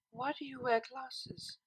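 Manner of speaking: slow, soft, far-off asking; as if heard from far away; distant, dreamy voice. Acted. Octavia asks Vinyl dreamily, as if from far away. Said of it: "Her own voice asked somewhere far away".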